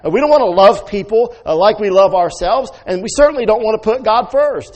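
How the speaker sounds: high, loud mock-indignant rant; mock outrage. Mocking a churchgoer fed up with God's rules, loud and high.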